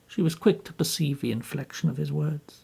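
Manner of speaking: ordinary delivery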